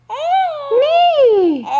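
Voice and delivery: very high, loud whinny; horse neigh. Pretend. Parent answers what the horsey says, whinnying. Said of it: "What does the horsey say?".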